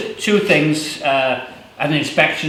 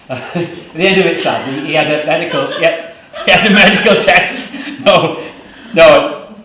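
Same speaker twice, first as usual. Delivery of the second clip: retake breaking into laughter; laughing; 3 semitones higher, 6 dB louder than usual. Shown as a demonstration. Poet cracking up retelling his brother's news. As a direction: giggle through the line at the joke. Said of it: "I'm not gonna say he died".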